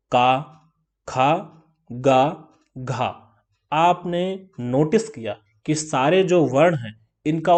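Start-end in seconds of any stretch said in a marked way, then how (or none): 0.1-3.3 का, खा, गा, घा each said clearly from the throat; demo sounds. Shown as a demonstration. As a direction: separate demo syllables का खा गा घा, voiced in the throat. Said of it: "का से शुरू करिए". (कंठ से स्पष्ट उच्चारण: का, खा, गा, घा)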